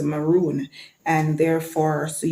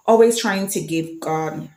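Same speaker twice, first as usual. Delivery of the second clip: ordinary talk, nothing marked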